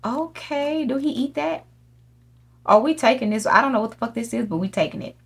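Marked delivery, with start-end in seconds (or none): 0.0-1.6 high, soft puzzled asking; high curious question. Real, not acted. Gamer asking up high what the frog eats.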